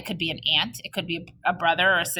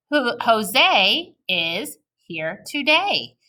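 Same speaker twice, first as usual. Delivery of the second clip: Jose is here today said slowly, word by word; slow choral reading; 2 semitones higher, much slower than usual. Shown as a demonstration. Teacher reading along with kids, slow and clear. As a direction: lead the class, very slowly. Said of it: "Try it one more time".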